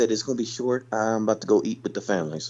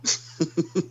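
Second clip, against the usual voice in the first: amused laugh; 8 semitones higher than usual. Real, not acted. Laughs at friend's joke.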